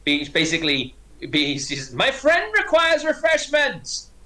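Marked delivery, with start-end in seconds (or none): 1.9-4.1 high, drawn-out booming announcement; shouting across the bar. Acted. Orc demanding service for her friend, high shout. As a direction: holler it to the whole bar. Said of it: "Sort of shouting across the bar".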